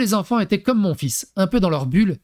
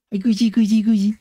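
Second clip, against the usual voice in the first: put-on light playful baby talk; cutesy baby talk; 3 semitones higher than usual. Shown as a demonstration. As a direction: tickle the child in a cutesy voice. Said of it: "en mode choupi, kawaii". (gazouillis mignon pour enfant)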